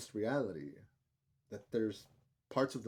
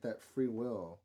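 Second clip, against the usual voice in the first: ordinary talk, nothing marked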